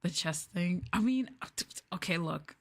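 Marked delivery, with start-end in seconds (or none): none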